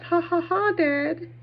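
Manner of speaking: gloating laugh. Retold or imitated.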